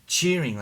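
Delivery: high loud burst of crowd cheering; mock crowd cheer. Telling of a vision, echoing the cheering crowd, mock cheer. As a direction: imitate a stadium roaring.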